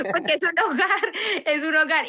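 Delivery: amused, laughing while telling it. Real, not acted. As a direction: giggle the words out as you say them. Laughing at her own prayer.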